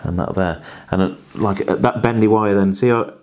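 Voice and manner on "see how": normal talking voice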